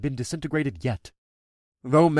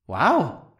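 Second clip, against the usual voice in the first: dragon's teasing wow; 3 semitones higher than usual. Acted.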